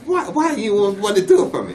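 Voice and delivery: a broad, high mock of Dusty asking a favour; imitating Dusty. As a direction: play Dusty as a high, stammering asker. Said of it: "and he asked me".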